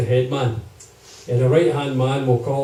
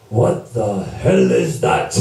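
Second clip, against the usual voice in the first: lower, slow, drawn-out demand; gruff boss from Leeds; 6 semitones lower, much slower than usual. Retold or imitated. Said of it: "he stops mid-sentence and goes".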